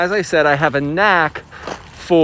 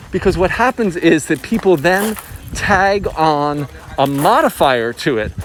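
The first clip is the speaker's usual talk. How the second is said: normal talking voice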